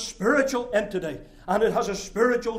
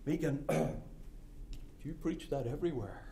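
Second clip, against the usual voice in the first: softer, slow mock question from a listener; imagined doubter; 6 semitones lower, 14 dB quieter than usual. Preacher voicing a doubter asking if he preaches it everywhere, soft and slow. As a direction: play a sceptic quietly challenging the preacher. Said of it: "Well, you say".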